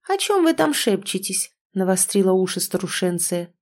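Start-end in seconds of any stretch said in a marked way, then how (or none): 0.1-1.5 high-pitched, inquisitive call; prying. A narrator's reading. The old woman interrupts the whispering friends, prying. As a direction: pitch it up, nosy and suspicious.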